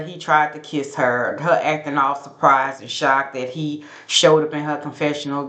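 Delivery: ordinary delivery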